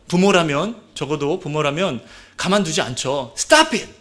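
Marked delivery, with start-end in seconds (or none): 3.4-3.9 sharp, high shout of alarm; strong shout. Acted. Parent shouting at a child playing somewhere dangerous. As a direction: yell to stop a child in danger. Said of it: "이렇게 강하게 말을 하지 않습니까".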